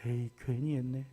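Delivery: a believer regretting venting to people; regretful mutter. Retold or imitated. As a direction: grumble to yourself with regret. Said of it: "되돌아서면 어떤 생각이 듭니까?".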